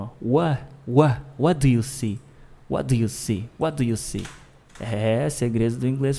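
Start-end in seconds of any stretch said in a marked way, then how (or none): 0.2-4.3 "what" with the T left off, sliding into "do you see"; cut-off T. Shown as a demonstration. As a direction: clip the T out of "what". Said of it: "vou falar cortado a letra T".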